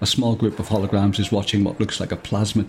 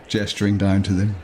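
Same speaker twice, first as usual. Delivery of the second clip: normal talking voice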